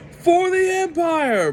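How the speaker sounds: very high, slow, drawn-out shout; hammed-up loyal soldier's war cry; mock battle cry. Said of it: "an ex-Imperial officer says".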